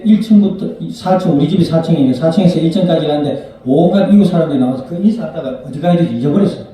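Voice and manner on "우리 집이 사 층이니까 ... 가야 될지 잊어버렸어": ordinary talk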